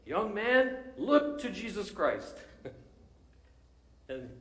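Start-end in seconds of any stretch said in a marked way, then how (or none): 0.0-2.4 high, loud, slow pulpit shout; preacher's shout. Retold or imitated. Primitive Methodist preacher shouting to the young man. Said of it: "he shouted as only a primitive Methodist can".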